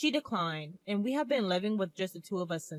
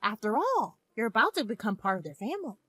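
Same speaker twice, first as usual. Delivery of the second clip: higher, fond mother voice; 6 semitones higher, 4 dB louder than usual. Acted. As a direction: gently advise like a loving mother.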